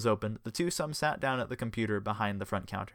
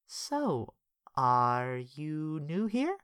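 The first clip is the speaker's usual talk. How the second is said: flirty; 6 semitones higher, much slower than usual. Acted. Smitten woman flirting.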